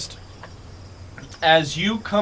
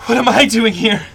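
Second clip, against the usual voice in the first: high, frantic screaming, building louder; 10 semitones higher, 11 dB louder than usual. Acted. Mud-covered woman crawling towards her pursuer, frantic screaming. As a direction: shriek it like someone losing her mind. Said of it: "bloodshot and frantic".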